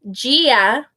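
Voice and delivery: usual speaking voice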